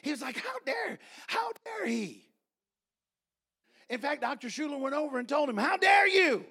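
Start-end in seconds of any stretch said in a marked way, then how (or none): 0.4-2.2 high-pitched, affronted outburst; indignant outrage. Retold or imitated. Preacher acting out Doctor Schuler's reaction, indignant. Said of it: "and he got mad". 5.6-6.5 loud, high confronting shout; indignant anger. Retold or imitated. Doctor Schuler confronting the landowner, angry shout. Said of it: "and he got mad".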